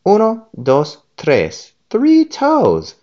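counting up high and loud; counting excitedly. A narrator's reading. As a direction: count the toes with rising glee. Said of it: "he pointed to the one and said".

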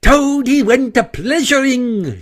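high, indignant battle yell. A narrator's reading. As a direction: throw the song back as an angry war whoop. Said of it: "he yelled".

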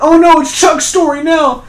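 loud, very high-pitched mock panic; mock alarm. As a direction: exclaim it way up high in fake dismay. Acted mock alarm at a plot turn.

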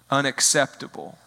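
ordinary delivery

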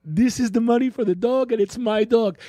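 put-on high girlfriend voice; girlfriend impression. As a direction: quote her up high. Quoting girlfriend.

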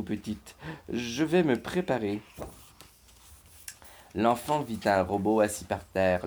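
usual speaking voice

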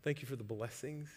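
softly voiced thanks to God; soft, humble prayer of thanks; quiet thankful prayer. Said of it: "go to the Lord with that emotion and say".

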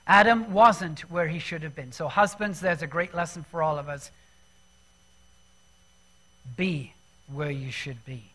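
normal talking voice

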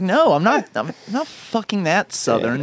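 high-pitched protest, slowing down; mock indignant retort. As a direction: protest it, dragging out the end. Retorting to those who mimic them.

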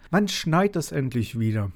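a hint of a sulky kid whining; mild child's complaint; whiny grumble. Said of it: "maulte er".

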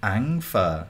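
"Ăn phở" as ang fuh, tone missed; tentative copy of Southern "Ăn phở"; learner's attempt. Shown as a demonstration. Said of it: "I can't hit that pho".

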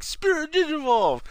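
very high, drawn-out transformation cry; imitating JP. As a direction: stretch it out like an anime attack call. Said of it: "He was like".

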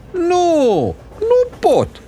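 very high squealed protest; bawling child. Acted. Trapped boy answering his grandmother, high bawling. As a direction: squeal it like a boy stuck inside.

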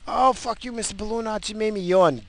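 high, whiny listener voice complaining; mock annoyed listener. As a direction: play a listener griping in a high voice.

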